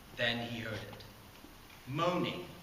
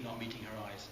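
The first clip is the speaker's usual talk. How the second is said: usual speaking voice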